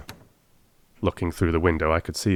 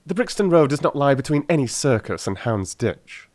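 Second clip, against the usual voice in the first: sharp; 7 semitones higher than usual. Acted. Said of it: "said Sherlock Holmes sharply".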